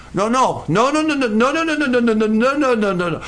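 string of quick high noes, rising and falling; rapid-fire objection. Acted. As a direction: cut him off with a run of noes. Preacher rebukes Balaam with repeated no.

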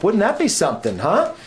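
high, lilting mock wonder; playful, tongue-in-cheek question; facetious. Real, not acted. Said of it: "I say that very facetiously".

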